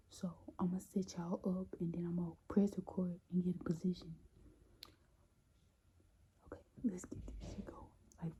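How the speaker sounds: hushed whisper so nobody hears; whisper, hiding in the next room. Real, not acted. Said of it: "I'm whispering right now because she is right next to my room".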